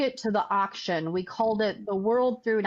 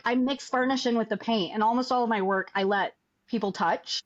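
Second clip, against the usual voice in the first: ordinary talk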